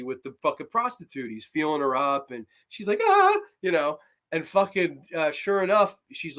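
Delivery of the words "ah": high, loud startled shriek; mock shriek. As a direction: yelp like you're grabbed. Prostitute shrieks when groped.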